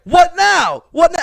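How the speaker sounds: gloating taunt. Retold or imitated. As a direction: rub it in. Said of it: "I was like".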